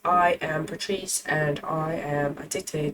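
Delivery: soft mock British accent. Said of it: "Can your voice be mine".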